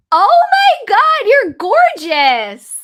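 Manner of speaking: loud, high, slow gushing praise; excited gush. Real, not acted. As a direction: gush over the photo. Sees friend's photo.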